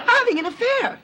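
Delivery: high, indignant outburst; exasperated with an evasive husband; angry accusation. Acted. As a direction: fling the charge out at high pitch. Wife accuses husband of affair.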